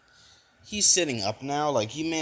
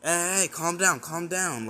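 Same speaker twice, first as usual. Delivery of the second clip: higher-pitched mock urgency; urgent calming; 6 semitones higher than usual. Friends rush to settle a panicking Deku, urgent calming. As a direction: talk someone down from a panic.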